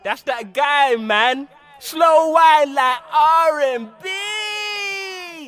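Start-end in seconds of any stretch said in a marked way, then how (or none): 1.8-5.5 high, loud, very drawn-out shout of the lyric; mock shouted line. Mocking the rival guy's song in a high stretched shout. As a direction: belt out the rival's line slowly to mock him.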